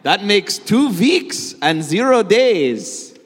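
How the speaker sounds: loud, slow, high accented cartoon count; Count Von Count. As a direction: draw out each number in gleeful triumph. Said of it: "the Count would absolutely love".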